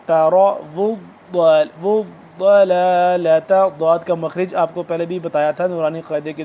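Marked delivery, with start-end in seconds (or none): 0.1-3.5 slow, loud chant, pressing the dad in ضلالۃ; drawn-out doubled dad. Shown as a demonstration. Teacher repeating the hard letter for learners, dad drawn out. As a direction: hold the thick dad, then stretch ضلالۃ. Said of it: "کھینچ کے پڑھنے ہو رہا ہے کیونکہ یہ تشدید ہو گیا".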